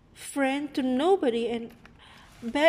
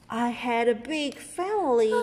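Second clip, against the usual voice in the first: ordinary delivery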